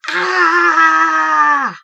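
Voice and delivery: loud high battle cry; roar. Acted. Cobwobblies roaring at the snail. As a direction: roar as they charge. Leaping at the creature.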